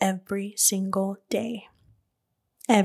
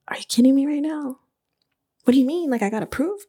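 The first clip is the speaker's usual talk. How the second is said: high, loud incredulous questions; excited disbelief; 6 semitones higher, 4 dB louder, faster than usual. Retold or imitated. Applicant on the approval call at Target, excited disbelief. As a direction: retell it as an excited, disbelieving outburst. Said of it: "I was screaming".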